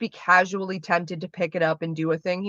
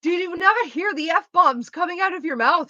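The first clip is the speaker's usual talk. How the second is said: much higher, disbelieving question; incredulous disbelief; 11 semitones higher, 3 dB louder than usual. Retold or imitated. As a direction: ask in utter disbelief. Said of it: "I'm like".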